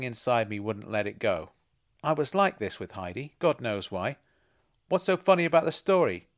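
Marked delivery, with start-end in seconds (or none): 4.9-6.3 sharp, louder retort with a higher pitch; a sharp, defensive snap at a friend; snapped. Acted. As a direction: snap back, irritated. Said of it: "I snapped".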